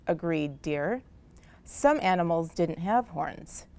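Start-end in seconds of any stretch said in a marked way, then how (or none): none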